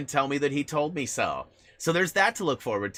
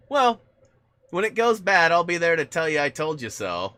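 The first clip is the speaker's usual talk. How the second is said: smug friend; 6 semitones higher, 7 dB louder than usual. Retold or imitated. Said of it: "this comment was made".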